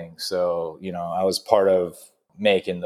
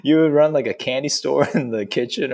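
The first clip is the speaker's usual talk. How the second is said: ordinary talk, nothing marked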